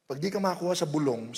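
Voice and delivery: usual speaking voice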